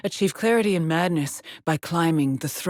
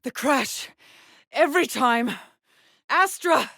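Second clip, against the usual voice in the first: high, breathless distress building to a cry; distressed outcry; 8 semitones higher than usual. Acted. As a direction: gasp it out, higher on each phrase. Distressed recall, calls out to Astra.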